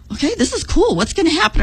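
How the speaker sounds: light, high-pitched anticipation; kinda excited. Retold or imitated. Runner nearing the cliff in a dream, kinda excited. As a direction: lift the pitch with eager curiosity. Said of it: "I'm kinda getting excited".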